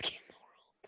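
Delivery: soft gushing whisper. Real, not acted. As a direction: breathe it out in a whisper. Gushing over a cute child.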